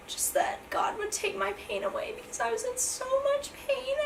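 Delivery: high, whispery voice choking up; on the verge of tears, recalling the pain; tearful. Real, not acted. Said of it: "Oh, I'm trying not to cry".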